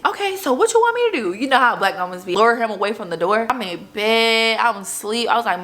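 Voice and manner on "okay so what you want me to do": exasperated mom, high. Retold or imitated. Mom's exasperated voice.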